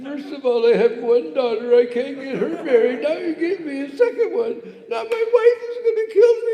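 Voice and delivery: high crying shout building to despair; crying Brahmin. Retold or imitated. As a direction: wail it out half crying, half laughing. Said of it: "that Brahmin really began to cry".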